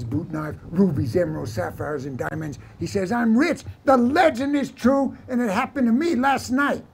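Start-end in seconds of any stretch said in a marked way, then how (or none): 3.1-6.8 high-pitched, eager proclamation; excited insistence. Acted. Vaquero telling the men the legend is true, excited insistence. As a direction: insist on it, pitch lifted throughout.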